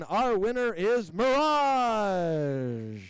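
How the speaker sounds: slow, drawn-out ring announcer call; winner announcement. Real, not acted. Announcer to the crowd naming the winner, drawn-out call.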